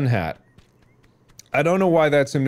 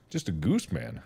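low plea; 6 semitones lower, 9 dB quieter than usual. Pretend. Same plea again, dropped low.

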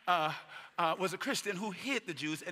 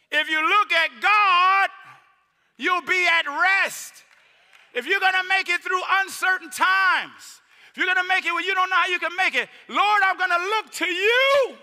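high, loud, slow preaching shout; full-force preaching; 13 semitones higher, 11 dB louder than usual. Acted. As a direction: preach it out over the congregation.

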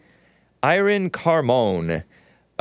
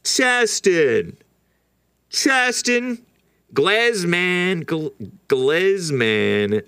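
spat-out name; 6 semitones higher, much slower than usual. Real, not acted. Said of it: "can't even say this guy's name without wanting to spit".

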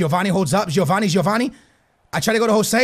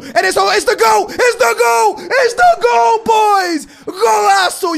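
screaming it higher and higher as the goal goes in; goal celebration shout; 10 semitones higher, 6 dB louder, slower than usual. Real, not acted. Gamer screaming the goal to his viewers, ecstatic shout. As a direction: roar the goal, pure joy, full voice. Goal scored in game commentary.

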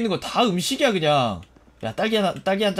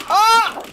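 very high, loud shriek; short sharp scream; startled scream; 14 semitones higher, 7 dB louder than usual. Real, not acted. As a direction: shriek at a sudden attack. Attacked in game.

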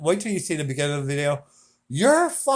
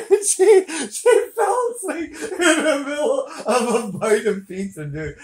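laughing; 9 semitones higher than usual. Real, not acted. Laughing while telling.

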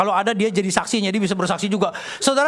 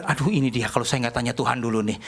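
low, softer thinking to himself; worried inner thought; 9 semitones lower, 4 dB quieter than usual. Retold or imitated. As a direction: voice a wary private thought, pitched low. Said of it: "saya mulai mikir". (membatin cemas dengan nada rendah)

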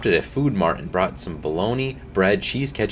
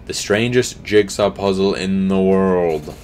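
eerie reading; 4 dB louder, slower than usual. Acted. Lisa reads box words.

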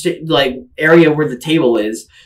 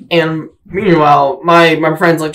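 ordinary delivery

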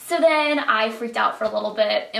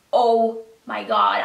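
slow, breathless oh my God; mild awestruck gasp; stunned; 6 dB louder, slower than usual. Retold or imitated. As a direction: caught off guard in disbelief. Security guard points at them.

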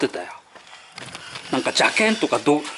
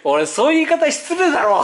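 high, indignant rebuke; retold rebuke; 9 semitones higher than usual. Man rebuking a rude host at dinner, indignant. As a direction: scold him for his rudeness. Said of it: "っつって".

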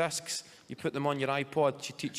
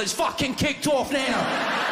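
high, drawn-out fan's yell; shouting; 11 semitones higher, 8 dB louder, slower than usual. Retold or imitated. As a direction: roar it out like a rowdy supporter. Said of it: "He shouts".

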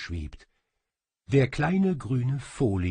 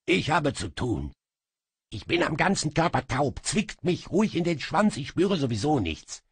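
higher, quick sulky imp; grumpy little demon; 7 semitones higher than usual. Acted. Little demon sulks at his master, grumpily. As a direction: grumble that you feel nothing.

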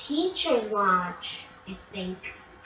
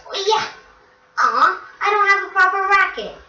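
excited then whiny; 9 semitones higher, 6 dB louder than usual. Real, not acted. Tennis racket disappointment.

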